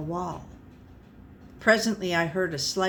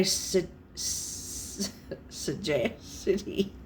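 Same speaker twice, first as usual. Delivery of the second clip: stumbling over word; 5 dB quieter than usual. Real, not acted.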